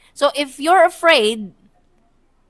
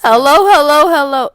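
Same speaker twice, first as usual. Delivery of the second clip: louder mic-check hello; sound check; 3 semitones higher, 8 dB louder than usual. Real, not acted. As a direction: test the mic, projecting. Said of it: "karon pa mag-sound check".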